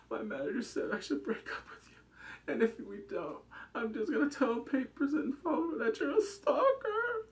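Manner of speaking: a breaking, tearful phone threat; a sobbing ex on the phone. Retold or imitated. Ex calls him in flashback, sobbing.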